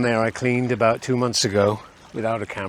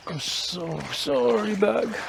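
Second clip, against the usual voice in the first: mock apology to bag; 10 semitones higher, 4 dB quieter, much slower than usual.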